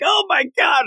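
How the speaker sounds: high, panicked squeal of shock; sudden high-pitched freak-out; freaked-out yelp. Retold or imitated. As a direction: shriek it like bugs are crawling up your leg. Present Mike freaks out at bugs.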